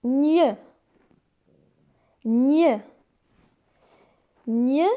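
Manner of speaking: gn repeated slowly and clearly as a single ny sound; repeated gn demo. Shown as a demonstration. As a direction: drill gn for the class. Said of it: "G N se prononce".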